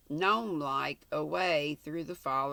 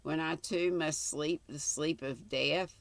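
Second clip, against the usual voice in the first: ordinary delivery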